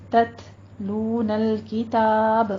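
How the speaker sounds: تتلون الکتاب recited slowly with breathy whispered تا; tajweed demo. Shown as a demonstration. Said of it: "تا کے پر ہمس کیجیے". (تتلون الکتاب میں تا پر ہمس کے ساتھ آہستہ تلاوت)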